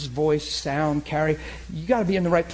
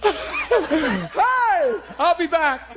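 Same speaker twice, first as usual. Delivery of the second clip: laughing quote; 11 semitones higher, much slower than usual. Retold or imitated. Laughing retelling.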